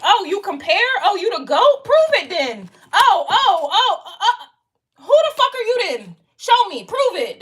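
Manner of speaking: mocking challenge. Mocking clients who question her worth, high sneering taunt. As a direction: dare them to prove it.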